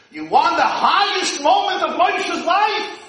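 loud, high preaching call; rhetorical shout. Acted. Rabbi asking the congregation, loud preaching shout. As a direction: shout the question to the hall.

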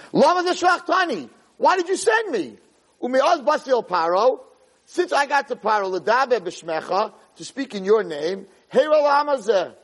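high-pitched, impassioned retelling of a protest; yelling accusation. Retold or imitated. Moshe protests to God over his failed mission, yelling. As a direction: challenge God as if wronged. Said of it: "you're yelling at me".

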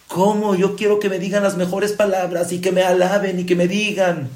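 high-pitched indignant demand for praise; hammed-up self-important protest; mock demand.

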